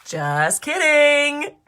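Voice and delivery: slow, loud, high teasing; playful tease. Acted. Narrator teases young listeners after the fake ending, slow and loud. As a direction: drag it out playfully.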